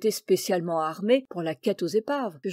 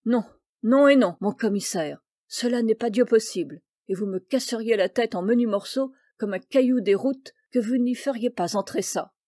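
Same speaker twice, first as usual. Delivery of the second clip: fierce outburst; 3 semitones higher, 5 dB louder than usual. Acted. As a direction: leap up and refuse with all your might. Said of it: "d'un sursaut farouche".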